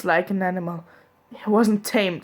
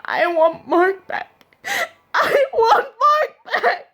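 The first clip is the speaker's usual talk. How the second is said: slow, high crying that climbs much higher; sobbing plea; 4 dB louder, slower than usual. Acted. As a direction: wail it, breaking more the second time. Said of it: "fell to the floor sobbing".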